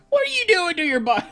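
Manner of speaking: high, loud, rushed impression; Greg. Streamer failing at Greg, high-pitched and rushed. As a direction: squawk it fast and high, nearly laughing. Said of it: "if I do in a high-pitched voice".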